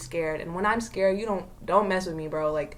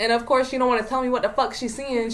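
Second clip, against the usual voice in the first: ordinary delivery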